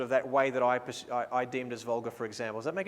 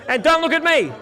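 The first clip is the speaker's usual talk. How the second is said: called out loud and high, weight on don't; loud instruction; 11 semitones higher, 11 dB louder than usual. Real, not acted. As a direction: shout "don't look at me" across the set. Directing bridal party across location.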